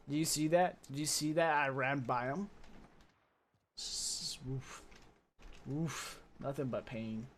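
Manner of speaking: usual speaking voice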